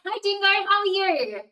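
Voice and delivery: high, loud happy hello; bright greeting. Real, not acted. Greeting a chatter.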